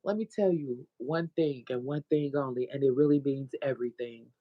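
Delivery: ordinary talk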